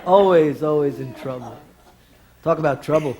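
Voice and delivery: ordinary talk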